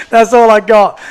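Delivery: amused repeat of a modest shrug; laughing. Real, not acted. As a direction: laugh it off, bashful. Laughing repeat after being praised.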